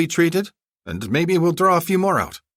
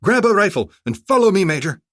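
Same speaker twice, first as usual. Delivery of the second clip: commanding order; 13 semitones higher, 8 dB louder than usual. Acted. Orders to his aide.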